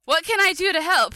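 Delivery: high, loud eager plea; mimicking Hope; Hope asking. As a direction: pipe up eager to help. Voicing Hope asking to help.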